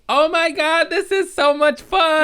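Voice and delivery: put-on very high, loud, delighted kid; squeaky joyful kid; little kid voice. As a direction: squeal it like a thrilled toddler. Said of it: "first thing he says".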